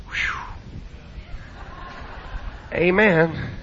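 ordinary talk

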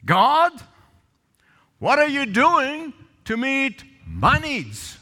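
mock an entitled prayer, stretching each phrase; demanding prayer. Believer demanding that God meet their needs, indignant.

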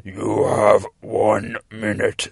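slow, loud, rough demon. Retold or imitated. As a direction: growl out the warning, drawn out. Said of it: "says the demon".

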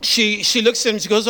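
ordinary talk

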